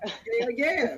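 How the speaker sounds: ordinary talk, nothing marked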